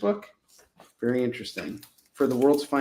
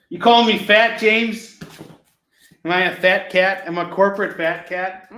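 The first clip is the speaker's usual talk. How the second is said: loud, raised-pitch playful indignation; mock offended; 5 semitones higher, 7 dB louder than usual. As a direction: challenge him as if insulted, hammed-up. Acting mock-offended at being called fat.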